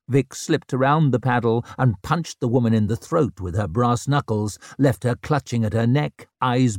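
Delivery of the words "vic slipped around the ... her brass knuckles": ordinary delivery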